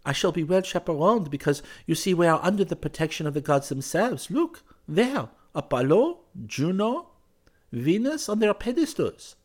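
put-on high, lively girl's voice; playful girl. Girl shows him her statue chaperones, playfully. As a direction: point out each name brightly.

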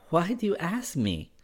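put-on high, tentative girl's question; trembling smile. A narrator's reading. Girl answers his question about chaperones, tremulously. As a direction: ask with a nervous smile. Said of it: "with a trembling smile said".

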